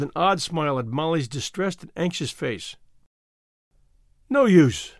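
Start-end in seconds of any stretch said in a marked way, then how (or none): 4.3-4.9 raised, louder grim resignation. A narrator's reading. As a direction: give up grimly and firmly. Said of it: "he said grimly".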